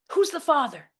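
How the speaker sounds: high, loud shriek; mom's shriek. Retold or imitated. Mother shrieking at her daughter over the phone. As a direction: shriek it as an accusation. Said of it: "my mom shrieks".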